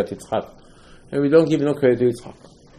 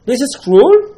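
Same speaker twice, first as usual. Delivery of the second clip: anguished protest; 11 semitones higher, 9 dB louder, slower than usual. Acted. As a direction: cry out, higher and louder. Avraham protests to Hashem.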